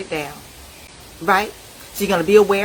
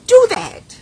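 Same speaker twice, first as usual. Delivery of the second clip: loud high command; 12 semitones higher, 10 dB louder than usual. Real, not acted. Preacher commanding listeners to let tears flow, loud and high. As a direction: shout it up high. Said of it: "loud urging".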